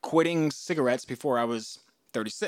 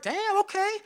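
impressed surprise. Retold or imitated. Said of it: "I was kind of like".

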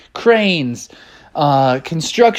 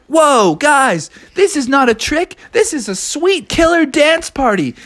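excited partiers; 10 semitones higher than usual. Acted. Animals at dance party.

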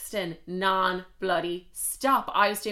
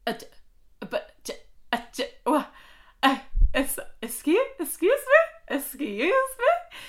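flabbergasted, much higher and louder; stunned disbelief; 9 semitones higher, 4 dB louder than usual. Retold or imitated. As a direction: sputter before squealing it out in shock. Stunned that he owns a yacht.

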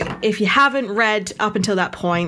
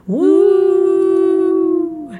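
high loud wolf howl; 11 semitones higher, 6 dB louder than usual. Shown as a demonstration. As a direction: throw your head back and howl. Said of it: "it sounds just like this".

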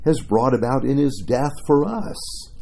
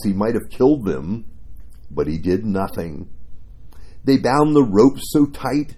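ordinary talk

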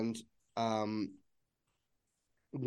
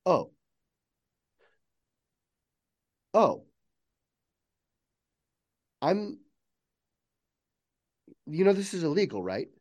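higher, halting dawning shock; stunned realization; 4 semitones higher than usual. Acted. AI realizing its new awareness.

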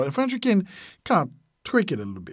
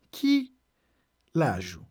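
broken in two. Shown as a demonstration. Teacher teaching how to ask someone's age, broken in two. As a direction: split the words apart for learners.

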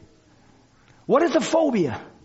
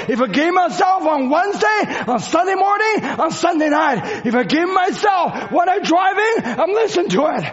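fiery preaching; 6 semitones higher than usual. Acted. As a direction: preach it out with full force. Preaches at full force.

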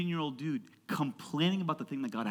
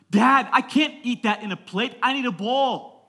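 high-pitched whiny kid imitation; picky child; 9 semitones higher, 9 dB louder than usual. Daughter objecting to square-cut food, picky child. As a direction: whine at dad like a fussy child.

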